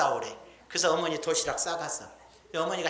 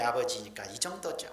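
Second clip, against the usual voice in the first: ordinary talk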